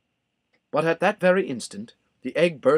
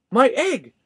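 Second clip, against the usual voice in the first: higher, louder startled cry; shouting elephant; 9 semitones higher, 5 dB louder than usual. A narrator's reading. Horton hearing his egg hatch, shouting. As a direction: call out as the egg stirs. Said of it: "shouted Horton".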